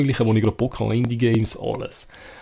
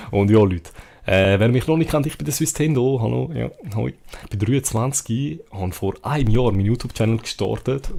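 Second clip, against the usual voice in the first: usual speaking voice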